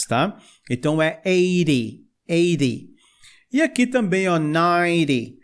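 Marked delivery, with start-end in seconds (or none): 1.2-2.9 eighty said eɾi twice. Shown as a demonstration. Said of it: "esse T fica com som meio de R". 4.4-5.4 ninety as najɾi. Shown as a demonstration. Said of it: "esse T fica com som meio de R".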